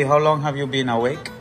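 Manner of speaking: ordinary delivery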